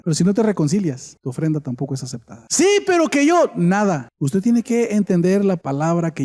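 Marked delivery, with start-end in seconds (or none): 2.5-3.5 high-pitched mock protest; protesting objection. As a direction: voice an objector butting in, pitch jumping up. (protesta fingida, en voz muy aguda)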